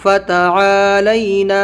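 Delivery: slow, عا in فتعالینا drawn out; stretch the ain. Shown as a demonstration. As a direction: hold عا long, keep لینا light. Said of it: "عین کو کھینچنا ہے". (فتعالینا میں عین کو کھینچ کر)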